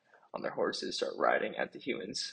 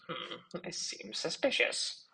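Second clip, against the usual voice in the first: suspicious villain; 9 semitones higher, slower than usual. Acted. Villain suspects the bunnies.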